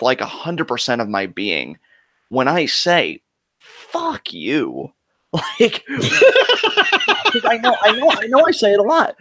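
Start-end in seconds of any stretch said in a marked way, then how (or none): 3.6-4.9 a slow, deliberate curse, not shouted; mean every word, low-key and weighty; heartfelt, dead serious. Real, not acted. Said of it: "I really mean it with". 5.6-9.2 high, breaking into laughter while talking; can't keep the laugh out of the words. Real, not acted. Both crack up after the curse.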